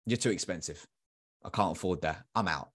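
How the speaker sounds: low, soft brush-off; subdued refusal. Acted. Seminar attendee turning down the speaker's price, quietly final. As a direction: drop the voice and bow out quietly.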